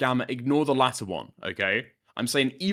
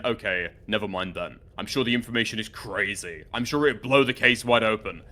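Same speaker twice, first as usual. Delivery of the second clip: sarcastic; 6 semitones lower than usual. Retold or imitated. Reading back own message about the case, dryly sarcastic. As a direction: read it out with dry sarcasm.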